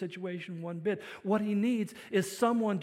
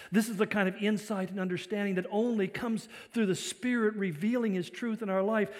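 ordinary talk

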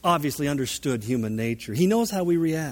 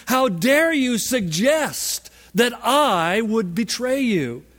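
drawn-out, higher-pitched outrage; indignant berating; 6 semitones higher, 3 dB louder, slower than usual. Retold or imitated. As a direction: berate him slowly, stretching each offended word. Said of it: "berating Jesus".

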